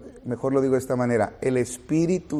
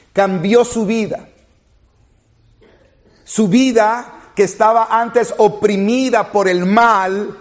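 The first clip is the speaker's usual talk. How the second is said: fervent preaching; 7 semitones higher, 6 dB louder than usual. Acted. Preacher to congregation, testifying that the Lord is real, fervent. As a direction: proclaim it with rising fervor.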